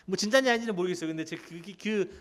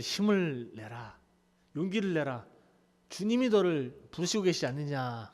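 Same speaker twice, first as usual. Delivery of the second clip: gentle encouragement; 3 semitones lower, 6 dB quieter than usual. Acted. Crowd softly urging the blind beggar to rise, tender. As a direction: coax him up gently.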